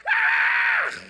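put-on high shriek of fright like a seventh grade girl; shrieking in mock terror at a snake; scream. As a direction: let out a sudden high scream. Scared scream at seeing snake.

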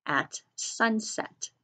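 careful teacher dictation, pausing between words; dictating a sentence. Shown as a demonstration. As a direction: dictate it to young writers word by word. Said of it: "So try that sentence".

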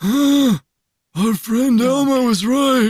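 lightly voiced generic old man; a hint of a slow old president. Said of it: "That's your generic old man voice".

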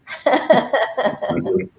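a high, teary laugh that cracks through; emotional laugh. Real, not acted. Judge laughs through tears after Barry's speech. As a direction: laugh to cover being choked up. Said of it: "I'm a little bit emotional".

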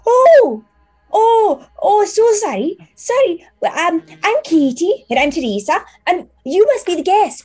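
very high, loud startled apologies; a maid caught out, gushing sorry; flustered teen maid. Acted. GM voicing a startled maid apologising to the guests, very high.

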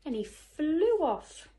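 ordinary delivery, nothing marked

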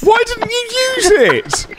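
very high, drawn-out outcry; incredulous high-pitched demand; outraged. Real, not acted. Outraged at teammate's mistake.

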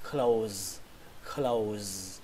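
close, ending on a buzzing z; English word close. Shown as a demonstration. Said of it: "Âm đầu tiên ... âm z đấy".

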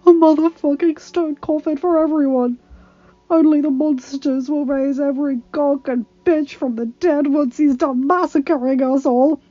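loud, frantic hopeless rant; panicked ranting. A narrator's reading. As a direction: rave in terror, voice high. Terrified man raving about death.

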